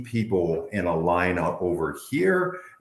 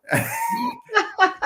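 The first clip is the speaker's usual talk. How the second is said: high laugh. Real, not acted. Laughing at own impression.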